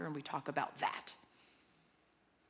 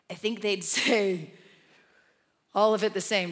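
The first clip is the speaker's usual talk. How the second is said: louder, fervent preaching, slowing on key lines; impassioned preaching; 4 semitones higher, 14 dB louder than usual. Real, not acted. Preacher urges congregation to pray continually, fervent and loud. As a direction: preach it out with full conviction.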